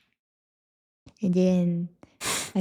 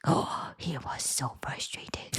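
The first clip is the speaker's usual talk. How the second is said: slow, low whisper of realization; awed whisper; 6 semitones lower, 11 dB quieter, slower than usual. Retold or imitated. Recalling the moment she understood him, slow whisper. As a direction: breathe it out, slowly taking it in. Realizing his frustration, inner thought.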